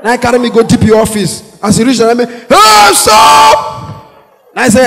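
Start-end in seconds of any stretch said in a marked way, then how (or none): 2.3-4.0 sudden shout. Retold or imitated. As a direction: yell to halt him. DPO yells.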